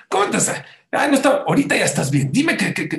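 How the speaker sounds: high, loud burst of retold protest; acted exasperation. Grandson urging weeping grandfather to explain, acted exasperation. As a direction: act out flustered pleading with someone crying.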